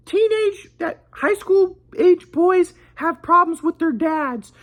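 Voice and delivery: high, slow lecturing grandpa voice; imitating grandpa. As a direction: parody the know-it-all grandpa, drawn out. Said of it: "My grandpa just says".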